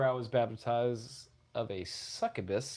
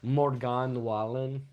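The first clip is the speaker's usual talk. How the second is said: drawn-out name; 5 dB louder, much slower than usual. Pretend. Imitating slow singing.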